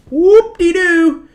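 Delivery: way up high and slow, mock-celebratory sing-song; drawn-out sarcastic cheer; sarcastic whoop-de-doo. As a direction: squeal a mocking whoop-de-doo. Mocking the sheep's gains.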